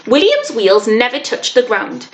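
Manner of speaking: ordinary delivery, nothing marked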